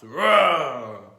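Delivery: loud angry roar, no words; roar of wrath. A narrator's reading. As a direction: let out a furious roar. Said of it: "let out a roar of wrath".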